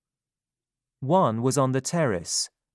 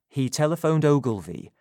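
usual speaking voice

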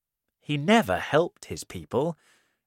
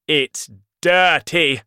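slow, loud, high-pitched snap; a furious king rejecting a coat; angry shout; 5 semitones higher, 11 dB louder, slower than usual. Acted. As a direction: spit it out in disgust, loud. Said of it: "the king shouted".

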